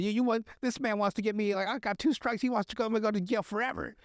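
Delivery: high-pitched agitated rant; flipping out. Acted. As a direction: play a man losing it over being set up. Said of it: "he's flipping out".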